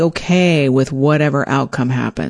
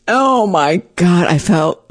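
normal talking voice

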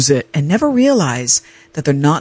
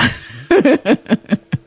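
light laugh; 8 semitones higher than usual. Real, not acted. Laughs at own joke.

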